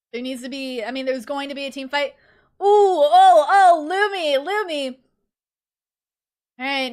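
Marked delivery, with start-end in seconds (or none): 2.6-5.0 excited exclaim. Real, not acted. Excited reaction during team fight.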